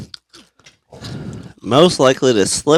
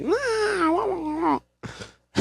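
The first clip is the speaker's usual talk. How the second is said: mock crying; 9 dB quieter than usual. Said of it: "and he's like".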